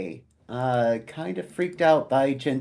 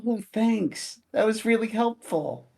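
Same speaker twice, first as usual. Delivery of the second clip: mock-cheerful, pitched higher; sarcastic thanks; 9 semitones higher than usual. Paladin mocks the guardian's vague answer, higher sarcastic thanks. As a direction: thank him with dripping sarcasm.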